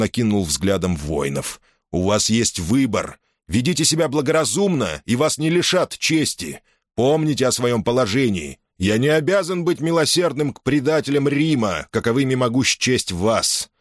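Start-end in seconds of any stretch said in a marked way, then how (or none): 1.9-13.7 high, firm, stern commander; stern general's warning. Acted. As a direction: warn them with authority. Caesar warns the soldiers.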